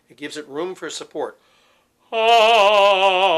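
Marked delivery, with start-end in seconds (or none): 2.1-3.4 loud, high ah with a raised palate; arched palate. Shown as a demonstration. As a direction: open up the arch and sing ah. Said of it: "raise the palate and give it a little bit of arch".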